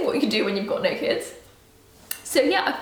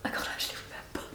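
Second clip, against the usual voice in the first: sheepish whisper; 14 dB quieter, faster than usual. Real, not acted.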